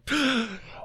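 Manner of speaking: high, sharp gasp of alarm. Retold or imitated. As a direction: gasp like you've spotted a disaster. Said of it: "I'm like".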